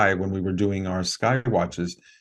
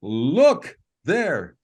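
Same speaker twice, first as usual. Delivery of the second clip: mild mock yell, pitched up; retold yell; 5 semitones higher than usual. Friend alerting the meditation circle, retold as a mild yell. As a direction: imitate a call without full volume. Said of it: "he yells out".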